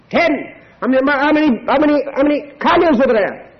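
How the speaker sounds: hammed-up tally of the herds; playful excited counting; mock bustling count. Abraham counting tithes, acted scene.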